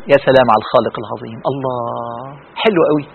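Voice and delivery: low, soft marvelling; quiet awe. Acted. Acts girl marvelling at God's creation. (انبهار خاشع بصوت منخفض)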